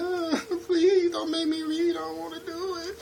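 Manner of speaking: put-on very high, childish whimper; mock whining. Teasing viewers who won't read subtitles, high mock whine. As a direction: beg like a whiny kid. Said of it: "stop your whining and crying".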